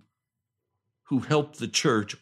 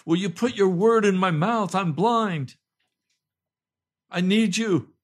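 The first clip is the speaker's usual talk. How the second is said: loud, higher-pitched plea in prayer; crying out; 7 semitones higher, 6 dB louder than usual. Retold or imitated. Pastor begs the Lord to bless his word, crying out. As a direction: cry urgently to the Lord. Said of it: "I'm crying out to the Lord and saying".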